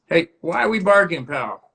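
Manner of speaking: mildly exasperated call to a barking dog; scolding a dog. Real, not acted. Host chiding the barking dog mid-stream, scolding.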